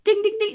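high, urgent ding ding ding; imitated bell dings. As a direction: mimic the alarm bell going off. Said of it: "it began dinging like crazy".

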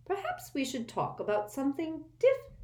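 brisk motherly change of subject; frowning. A narrator's reading.

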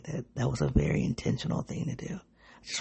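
hushed, breathy whisper of a bitter truth; whispered under the breath; whispered aside. Real, not acted. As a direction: drop to a whisper as if confessing it. Bitter aside about her sister.

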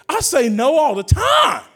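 slow, loud and high; loud, deliberate declaration; emphatic shout. Real, not acted. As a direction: hammer each word home slowly. Emphatic preaching line.

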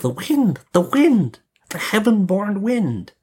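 put-on high, drawn-out children's voices; children's answer. A narrator's reading.

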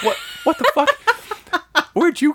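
high-pitched startled squawk breaking into a laugh; shocked yelp that cracks into laughter; startled laughing outburst. Retold or imitated. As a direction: jump out of your skin, then laugh. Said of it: "he scared the shit out of me".

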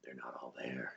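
breathy conspiratorial whisper. Acted. Whispering a joke to his co-host. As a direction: lean in and whisper it.

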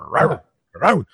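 high-pitched mock dog bark; imitated bark. Retelling how the big man barks at the bully, imitated bark. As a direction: bark it out twice, playfully. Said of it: "barks at him".

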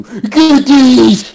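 mock catchphrase. Mock catchphrase in imitated quote.

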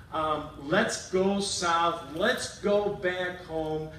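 slow, steady encouragement; reassuring God. Retold or imitated. God reaffirming Jacob, slow and steady. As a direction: draw it out calmly, very slowly. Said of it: "God reaffirms Jacob".

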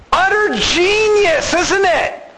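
high, slow, dry sarcasm; mock their bright idea; sarcastic. Real, not acted. Mocking the Israelites' plan.

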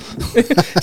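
sudden high-pitched laugh. Real, not acted. Teller laughing at Jeremy's reply, high laugh. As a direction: crack up briefly. Laughing after story beat.

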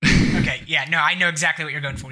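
usual speaking voice

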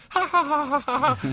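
high, loud mock guffaw heard through a curtain; a buddy's laughter from the next room, mocked high and loud; imitating a loud laugh. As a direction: bark out a silly laugh, pitched high. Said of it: "and I hear".

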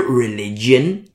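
religion drawn out slowly in scare quotes; air quotes. Shown as a demonstration. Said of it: "I'm doing my air quotes here".

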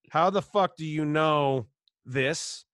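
slow, drawn-out disbelief; slow stunned question to the mentor; slow incredulous. Retold or imitated. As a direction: spell out each word like you can't believe it.

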